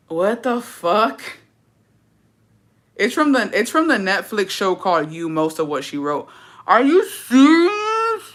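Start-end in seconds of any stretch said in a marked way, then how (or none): 0.1-1.2 slow, drawn-out disbelief; stunned what the. Retold or imitated. After reading the whole email, slow stunned disbelief. 6.6-8.2 much higher, slow and louder; shocked disbelief. Real, not acted. Reacting to the creepy email, high slow disbelief.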